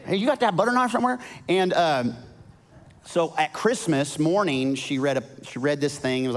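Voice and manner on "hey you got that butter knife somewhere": high, fast jokey aside; mock casual joke. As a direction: toss it off as a silly dad joke.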